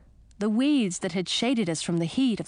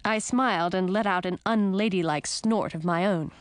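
ordinary delivery, nothing marked

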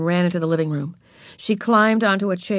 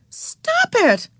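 very high, urgent command; stern order. A narrator's reading. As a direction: order a little sister to stop, voice shooting up. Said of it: "ordered Beezus".

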